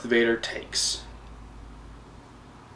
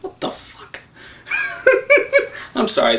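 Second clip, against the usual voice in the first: normal talking voice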